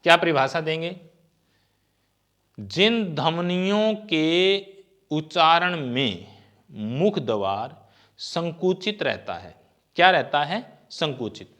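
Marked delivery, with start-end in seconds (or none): 2.6-9.5 slow, word-by-word definition; slow dictation. Shown as a demonstration. Teacher dictating the definition to students, slowly. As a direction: spell it out slowly for notes. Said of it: "dictating the definition".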